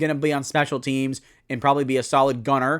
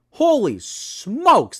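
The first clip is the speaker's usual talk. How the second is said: surprised; 7 semitones higher, 4 dB louder, much slower than usual. Real, not acted. Amazed at depth chart value.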